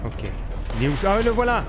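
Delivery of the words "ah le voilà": excited. Real, not acted. Spotting the hidden item.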